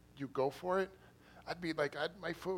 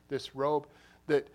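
loud emphatic preaching; 10 dB louder, slower than usual. Real, not acted. Preacher punching a phrase.

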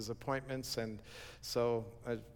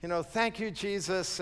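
much higher and louder, thrilled praise; joyful exclamation; 7 semitones higher, 4 dB louder than usual. Retold or imitated. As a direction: burst out thanking Jesus for the rescue. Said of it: "And I'm going".

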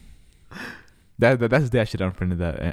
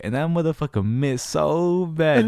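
higher, louder, stretched out slowly; excited disbelief; 6 semitones higher, 4 dB louder, slower than usual. Real, not acted. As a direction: relive the shock of the bad throw. Retelling the binder miss.

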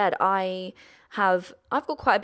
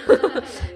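light laugh; 10 semitones higher, 5 dB louder than usual. Real, not acted. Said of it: "laughing at herself".

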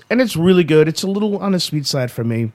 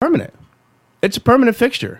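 much higher, stressed "Permanent"; emphatic; 10 semitones higher, faster than usual. Real, not acted. Correcting cohost, stressing "permanent" high. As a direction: hit "Permanent" high and firm.

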